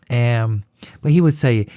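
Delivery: normal talking voice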